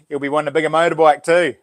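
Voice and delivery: ordinary talk, nothing marked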